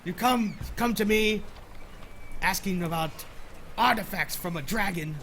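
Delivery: loud gruff seadog scolding; gruff old fisherman. Acted. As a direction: chide him like an old shipmate. Sailor NPC scolds friend.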